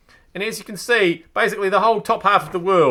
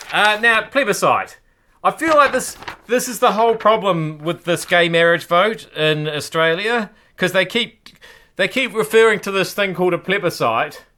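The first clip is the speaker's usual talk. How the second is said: ordinary talk, nothing marked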